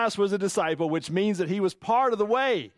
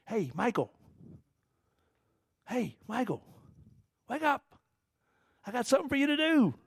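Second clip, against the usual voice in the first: gentle wake-up call; 4 semitones higher, 4 dB quieter than usual. Acted. Spirit voiced waking Michael.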